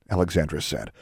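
plain reading voice

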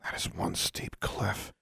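breathless whisper, slow; hushed awe. Acted. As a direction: whisper it slowly, awed by the height. Staring up at the cliff.

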